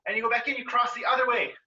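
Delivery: ordinary talk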